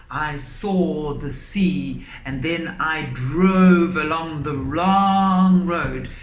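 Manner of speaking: slow, loud and hammed up; over-the-top delivery. Shown as a demonstration. Teacher mocking pupils who over gesture while reciting. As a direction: ham up every word like a bad actor. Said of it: "over gesture".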